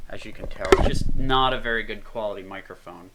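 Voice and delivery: normal talking voice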